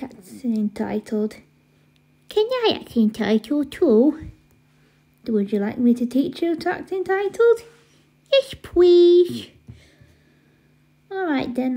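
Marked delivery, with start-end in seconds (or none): 2.3-4.3 put-on eager babyish little brother; cutesy baby talk. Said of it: "stop talking in that stupid baby voice". 8.3-9.5 put-on loud, high babyish eagerness; high, excited baby talk. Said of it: "stop talking in that stupid baby voice".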